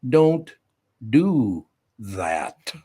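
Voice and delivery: put-on little boy's stern don't do that; imitating a stern little boy. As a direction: mimic the grandson's stern scolding. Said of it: "looks at me and goes".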